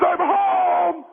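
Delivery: high, sustained homecoming call; husband announcing himself, last word held. Acted. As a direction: sing it out through the house. Husband calls out on arriving home.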